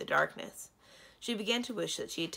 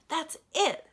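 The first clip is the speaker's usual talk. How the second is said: eager recognition; 11 semitones higher, 7 dB louder than usual. Acted. Prince recognizes the name.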